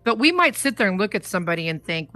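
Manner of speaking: ordinary talk, nothing marked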